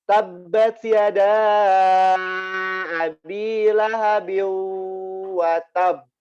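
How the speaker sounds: slow, drawn-out tartil chant; Quran recitation. Shown as a demonstration. Teacher reciting a Quran verse for students, slow chant. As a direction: recite the verse slowly, letting the qalqalah bounce. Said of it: "Misalnya dalam surat Al-Ahzab ayat satu".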